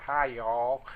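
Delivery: rough attempt at a Tennessee accent. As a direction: imitate a Tennessee drawl, not very well. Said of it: "really good, you know, Tennessee accent".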